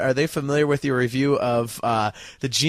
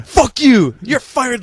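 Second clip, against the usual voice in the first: higher, louder mock yell; 8 semitones higher, 7 dB louder, much slower than usual. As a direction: snap it like an angry guitarist.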